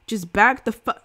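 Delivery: loud, cut-off threat; angry shout. Acted. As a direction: bark it before being shot. Uzi threatens the crowd.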